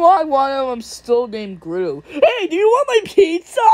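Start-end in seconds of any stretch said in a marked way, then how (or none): 2.1-3.6 high, eager cartoon seller; excitable cartoon voice, pitched way up; pushy pizza pitch. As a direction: pipe up high with the offer. Pizza character returns with the offer.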